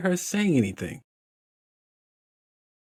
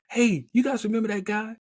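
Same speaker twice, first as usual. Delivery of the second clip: higher-pitched excited call; 7 semitones higher than usual. Retold or imitated. Nurses calling others to see him.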